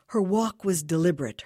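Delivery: plain reading voice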